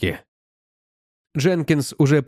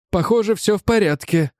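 lightly higher robot voice; a hint of a reviving robot; confident robot voice; 6 semitones higher than usual. A narrator's reading. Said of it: "Голос робота стал громче, в нём появились интонации".

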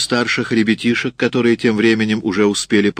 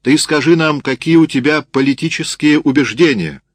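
raised, loud, pressing voice; shouted interrogation; 6 semitones higher, 4 dB louder than usual. A narrator's reading. Sergeant demanding a peasant's political views, shouting. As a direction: push the question impatiently. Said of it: "закричал сержант".

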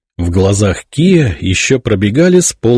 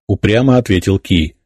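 ordinary talk

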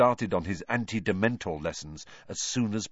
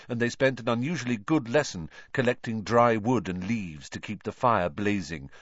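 ordinary delivery, nothing marked

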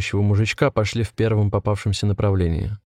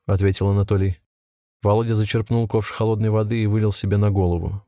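ordinary delivery, nothing marked

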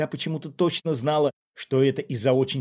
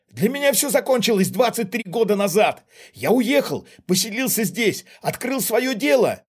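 loud, much higher protest; agitated outburst; 9 semitones higher, 6 dB louder than usual. A narrator's reading. Father insists it ended long ago, agitated outburst. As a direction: keep crying out in self-defence.

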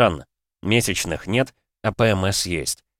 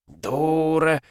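despairing groan, pitched up; 6 semitones higher than usual. A narrator's reading. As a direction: moan it out hopelessly. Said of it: "застонал Файлер". (стонущим голосом)

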